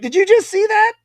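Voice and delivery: high, loud burst of disbelief; amazed exclamation. Retold or imitated. Turning to Travis after the knockout, amazed. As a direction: squeal it to your buddy, astonished.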